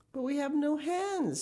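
high-pitched objection; puzzled little boy protesting; protesting child. Acted. Son protests the order.

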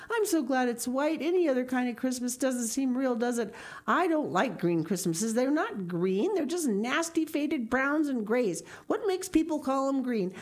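put-on lively, high-pitched young girl; chattering Anne. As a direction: chatter on with excited opinions.

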